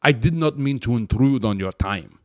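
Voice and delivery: put-on Slovakian accent; stiff, mock Slovakian English. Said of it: "She'll be, uh, Slovakian".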